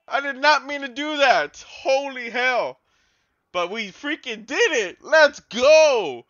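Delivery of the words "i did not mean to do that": ordinary delivery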